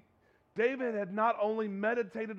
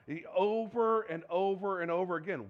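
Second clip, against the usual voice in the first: normal talking voice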